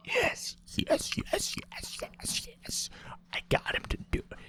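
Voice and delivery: hissed, whispered celebration; excited repeated whispers; gleeful whisper. Real, not acted. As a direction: whisper a secret triumph to yourself. Secret triumph, glass of water coming.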